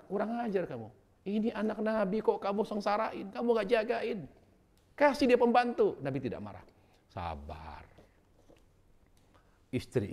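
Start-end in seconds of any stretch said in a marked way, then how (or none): 0.1-6.0 high, loud furious rebuke; angry scolding. Pretend. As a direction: scold the son-in-law in open rage, rising to a shout. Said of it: "Rasulullah itu memang marah". (marah membentak dengan suara tinggi dan keras)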